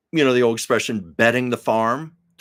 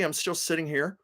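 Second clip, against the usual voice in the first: ordinary talk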